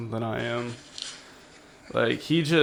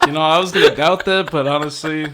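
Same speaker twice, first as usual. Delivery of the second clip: loud laughing; 6 semitones higher, 11 dB louder than usual. Real, not acted. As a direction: crack up while talking. Reacting to HIV story.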